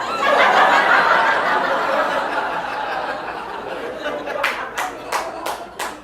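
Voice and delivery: high breathy burst of laughter; wheezing laugh. Real, not acted. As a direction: laugh helplessly, almost silent. Big laugh at friend's joke.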